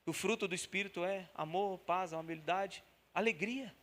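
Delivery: usual speaking voice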